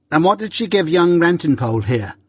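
lightly voiced demanding sister; sharp demand. A narrator's reading. Sister sharply questions Joe.

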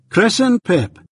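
high, questioning stranger mishearing the name; puzzled stranger. A narrator's reading. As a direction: ask it high, unsure of the name.

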